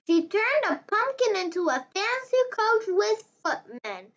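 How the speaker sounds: ordinary delivery, nothing marked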